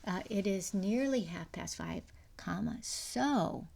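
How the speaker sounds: "comma, so" drawn out slowly, leaning on "so"; slow stress on so. Shown as a demonstration. Teacher shows the corrected sentence, slow and stressed. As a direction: stretch "comma, so" slowly to show the fix. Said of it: "use a coordinating conjunction".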